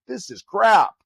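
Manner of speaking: high, loud scoff of disgust; exasperated outburst. Retold or imitated. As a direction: spit it out like you can't believe it. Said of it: "but I'm like".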